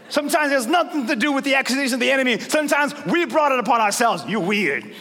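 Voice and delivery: fiery pastor charging the church to act; full-force preaching. Acted. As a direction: preach it hard to wake the room.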